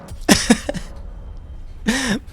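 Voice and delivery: rire aigu et soufflé; éclat de rire sans voix. Real, not acted. Fou rire après la vanne ratée. (rire soufflé aigu)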